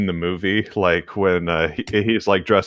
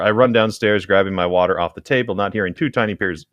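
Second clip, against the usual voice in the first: usual speaking voice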